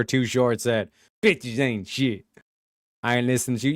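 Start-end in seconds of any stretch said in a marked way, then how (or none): 1.2-2.2 slow, deep mock-wise delivery; mock solemn quote. Quoting [name] as a philosopher, slow mock-solemn. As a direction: intone it like a philosopher's maxim.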